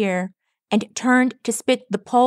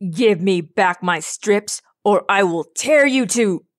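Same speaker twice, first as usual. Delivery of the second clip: menacing put-on villain voice; angry villain. A narrator's reading. Thunderhorn shaking a fist at Rainbow, menacing threat. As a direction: threaten in character, swelling on the threat.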